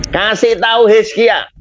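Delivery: a hint of God speaking to the prophet; voicing God. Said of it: "Tuhan berfirman kepada nabinya".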